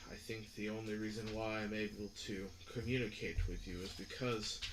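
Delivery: low, quiet, measured god voice; deep mysterious voice. Acted. GM voicing deep entity.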